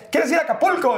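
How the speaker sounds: high-pitched imitated invitation; colleague's eager offer. Radio colleague offering an Acapulco trip, excited imitation.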